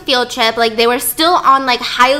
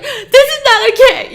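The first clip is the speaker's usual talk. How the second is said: higher and louder, said openly; loud blunt protest; 12 semitones higher, 6 dB louder than usual. Shown as a demonstration. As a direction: say it out loud, upfront. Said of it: "I'd rather you be like".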